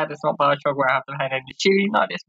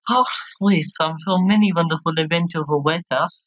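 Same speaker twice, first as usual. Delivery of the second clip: put-on gushing French accent; theatrical French-accented delight; slower than usual. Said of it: "doing this lovely French accent".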